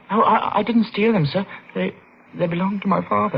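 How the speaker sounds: put-on frightened boy offering coins to a brute; nervous boy. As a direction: stammer it out scared and eager. Boy denying theft.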